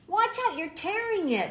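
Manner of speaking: high, loud yell at the little brother; big brother yelling at Steve over the kite. Acted. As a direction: shout it out in alarm. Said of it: "his brother yelled".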